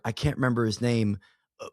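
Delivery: ordinary talk, nothing marked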